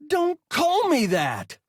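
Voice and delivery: high, loud, slow snap. A narrator's reading. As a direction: shout it shrill and slow. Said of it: "his voice was higher, louder".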